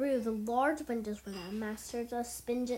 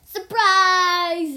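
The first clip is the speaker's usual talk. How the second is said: very loud, high, drawn-out shout; spring it on someone at full volume; shouted surprise; 7 semitones higher, 16 dB louder than usual. A narrator's reading. Ninja spring their trick on Garmadon, loud shout. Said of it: "the ninja shouted".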